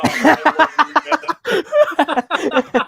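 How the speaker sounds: loud, high laughing gush; loud laughing delight. Real, not acted. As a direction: burst out laughing with love. Laughing at friend's text.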